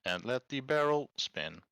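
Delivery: slow robotic announcer. Acted. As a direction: read it slowly like a robot. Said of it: "it is a great robot voice".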